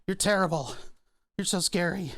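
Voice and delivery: mock scared. Mock fear of puppy.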